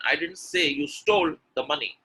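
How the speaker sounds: "stole" picked out and held; emphatic "stole"; stress on stole. Shown as a demonstration. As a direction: lean on "stole" before a short break. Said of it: "when I'm stressing on stole".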